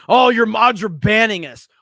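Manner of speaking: hammed-up whine about bans; mocking whiny chatters. Streamer mocking chatters who complain about bans, whining.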